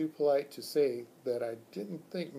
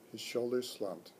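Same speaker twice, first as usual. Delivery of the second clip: ordinary delivery, nothing marked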